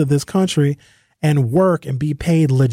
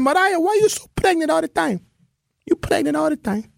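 mock Greek-accented broken English, higher pitched; Giannis impression; 12 semitones higher than usual. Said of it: "Giannis is not an American".

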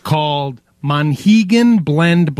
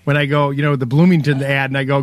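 usual speaking voice

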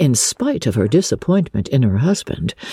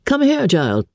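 higher-pitched, mild summons; calling out; 7 semitones higher than usual. A narrator's reading. Father summoning his daughter as she appears, lightly calling out. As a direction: call to the child without shouting. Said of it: "cried her father as she appeared".

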